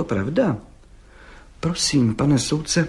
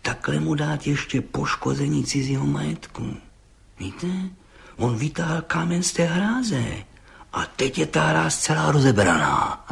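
hushed, scheming put-on voice; vengeful suggestion; 3 semitones higher, 4 dB quieter than usual. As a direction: propose the revenge slyly, keeping it quiet. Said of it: "řekl mstivě".

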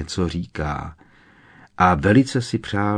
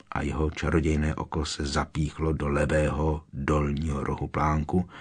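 usual speaking voice

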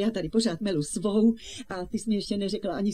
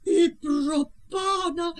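drawn-out, higher gasp of dismay; shocked exclamation; 5 semitones higher, 3 dB louder, slower than usual. Acted. Aunt hears of the steamboat accident, shocked. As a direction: exclaim in slow, rising alarm.

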